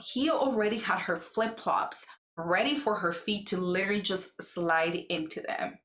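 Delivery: ordinary talk, nothing marked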